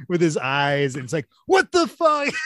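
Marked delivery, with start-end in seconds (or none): 1.5-2.3 high, loud mock alarm; retold shocked reaction. Voicing the reaction to the face among skulls, mock shocked. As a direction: blurt it out high and amused. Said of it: "then you see Marty Feldman's face".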